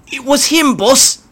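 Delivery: very high, whiny goblin; blaming grot. Acted. Icky shifting blame to the boss, whiny. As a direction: point the finger fast.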